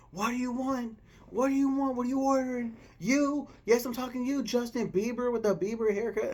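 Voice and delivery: high, loud mocking shout; rude waitress yelling. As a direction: bark at him like a rude waitress. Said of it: "this woman said".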